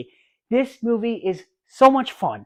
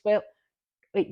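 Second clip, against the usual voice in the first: normal talking voice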